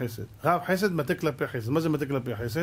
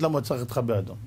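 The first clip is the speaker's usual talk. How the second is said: ordinary talk, nothing marked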